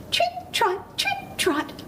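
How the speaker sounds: high, crisp trip-trot; tiny goat trotting along; little hoof steps. A narrator's reading. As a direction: tap out light, high hoofbeats in words. Little goat's hooves crossing bridge.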